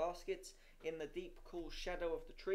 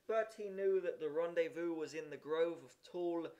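usual speaking voice

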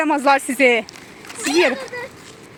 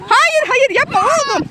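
sharp shout; 8 semitones higher, slower than usual. Real, not acted. Mother shouting at son to stop hitting sister.

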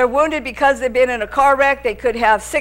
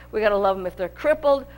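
usual speaking voice

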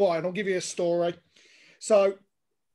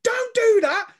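high, loud mock protest; imitated outraged cry; 13 semitones higher, 8 dB louder, slower than usual. Rival fans reacting to the old man, loud mock wail. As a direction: cry out as the offended crowd.

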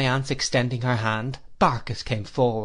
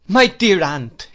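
higher, louder, slow cry of delighted surprise; glad cry; 6 semitones higher, 8 dB louder, slower than usual. A narrator's reading. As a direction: call out to a dear aunt you didn't expect. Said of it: "cried I".

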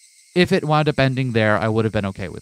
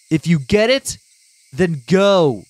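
angry shouting; 6 semitones higher, 6 dB louder, slower than usual. Acted. Angry Keiichi dismissing Rena.

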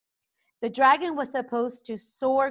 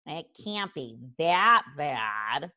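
put-on low doubtful boy; 5 semitones lower, slower than usual. Fish Legs doubtful.

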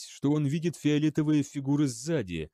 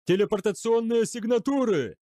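high-pitched panicked yell; alarmed howl; 10 semitones higher, 4 dB louder than usual. A narrator's reading. Tracking officer spotting teleporters, howling in alarm. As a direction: howl the warning. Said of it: "взвыл офицер слежения".

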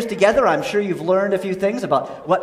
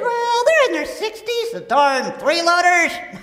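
squeaky ventriloquist dummy; 6 semitones higher than usual. Acted. Dummy answers about kids.

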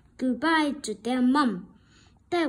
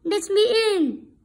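higher, louder calling wolf; 7 semitones higher, 4 dB louder than usual. Acted. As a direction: call out sweetly to lure the pig in. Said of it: "he called".

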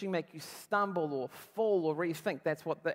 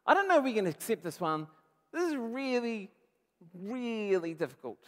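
disciples complaining; 4 semitones higher, 4 dB louder than usual. Acted. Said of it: "That's their question".

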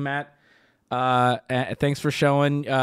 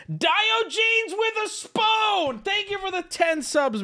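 high, drawn-out hype call; announcing shout-out; slower than usual. Acted. Streamer hailing a gifted-subs donor, drawn-out shout-out. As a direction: stretch the name out high like an announcer.

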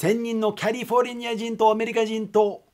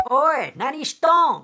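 imitating a classmate calling out from a window; drawn-out, higher call, as if hailing someone; 呼びかけ; 6 semitones higher, 4 dB louder than usual. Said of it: "って声をかけたの". (窓から友達に声をかける真似)

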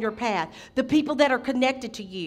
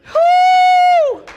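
long, high, loud "woo"; praise whoop; 13 dB louder than usual. Real, not acted. Preacher breaks into praise mid-sermon, whooping. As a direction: let out a piercing sustained whoop.

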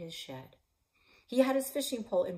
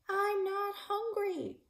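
shouted protest; 4 dB louder, slower than usual. Acted. Kid shouting to mom.

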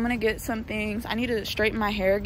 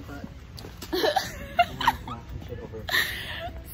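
laughing till near crying, high and breathy; high wheezing laugh; 6 dB quieter than usual. Real, not acted.

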